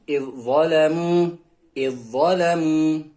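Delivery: "Idzalamu" slowly, only a heavy doubled dha; zal merged into dha. Shown as a demonstration. Teacher models zal disappearing into dha, slow recitation. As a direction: stretch "Idzalamu" with no separate dz. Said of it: "huruf zal sukunnya hilang".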